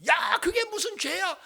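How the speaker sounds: loud, high exclamation; retold mother's rebuke. Mother dismissing daughter's confession, loud high rebuke. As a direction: imitate an exasperated mother.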